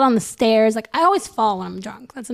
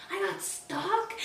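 incredulous; 5 semitones higher, 11 dB quieter, slower than usual. Retold or imitated. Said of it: "I started getting angry".

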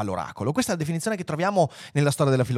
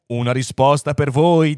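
firm, louder pronouncement; solemn; 7 dB louder than usual. A narrator's reading. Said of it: "disse solenne pensiero profondo". (annuncio forte e solenne)

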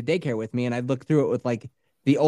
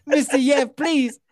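put-on high, pleading boy with Italian accent; mock Italian-sounding kid; 7 semitones higher, slower than usual. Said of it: "he comes up and he's like".

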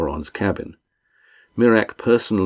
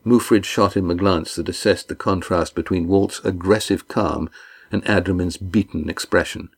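usual speaking voice

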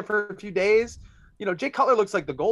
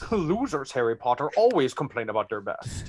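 Sean Connery impression; 2 semitones lower than usual. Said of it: "wow, that was the worst".

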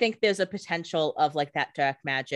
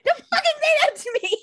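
screeching much higher and louder; furious scream; 8 dB louder than usual. Retold or imitated. Co-host shrieks at Stefan in mock rage. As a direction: yell it in mock outrage.

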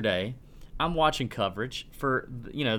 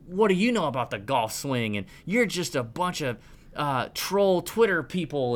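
higher, mock-indignant Twitter critic; mocking angry followers; 7 semitones higher than usual. Host mimicking angry Faldo followers with high mock outrage. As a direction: sneer back at him like an offended fan. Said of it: "They were coming at me".

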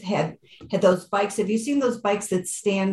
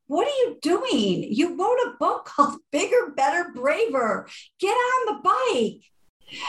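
retold yell; 9 semitones higher than usual. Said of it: "And he yelled at me".

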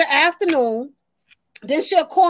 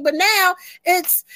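ordinary delivery

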